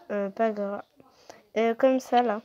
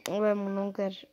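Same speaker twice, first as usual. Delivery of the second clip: breathy playful whisper; 3 semitones lower, 6 dB quieter than usual. Real, not acted. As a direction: whisper it teasingly. Unvoiced, remark on own language.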